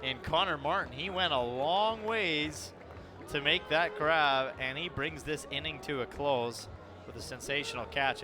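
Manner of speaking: drawn-out, emphatic sportscaster call; excited play-by-play. Real, not acted. Announcer praising a long run for the grab, excited play-by-play. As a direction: stretch out the big moments of the call.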